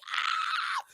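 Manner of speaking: high, short startled "Ah!"; startled cry. Retold or imitated. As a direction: yelp as if jolted by a shock. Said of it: "I was like".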